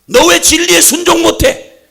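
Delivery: loud, high reproach; the Lord loudly rebuking a listener; rebuking question. Acted. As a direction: press the question hard. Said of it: "주님이 물으시면".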